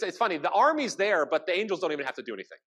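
normal talking voice

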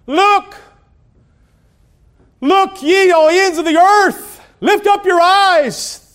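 high, loud proclamation; calling out. Real, not acted. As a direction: cry out to all the earth. Preacher calls out to congregation.

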